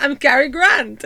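hammed-up Cary Grant, declaring himself; retold party-game Cary Grant impression; bad Cary Grant. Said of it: "impression of Cary Grant".

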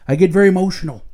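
usual speaking voice

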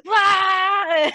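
high, loud mock scream of frustration; frustrated yell. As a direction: yell into a pillow. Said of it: "I'm like".

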